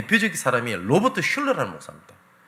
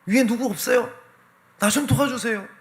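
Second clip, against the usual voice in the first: calling out for help; 11 semitones higher than usual. Retold or imitated. Young man dangling from a branch, calling up the cliff for help. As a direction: yell upward to anyone above for help. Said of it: "위를 보고 소리치는 겁니다".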